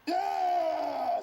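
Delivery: loud, high cheer; eager servants' shout. Retold or imitated. Servants answering Jehu with a loud gleeful yell. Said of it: "They like".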